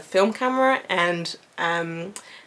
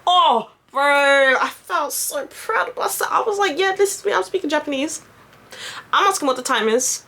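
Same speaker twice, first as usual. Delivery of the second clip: raised, excited bragging; higher, elated self-mocking boast; giddy pride; 6 semitones higher than usual. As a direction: gush with pride. Proud of speaking Japanese.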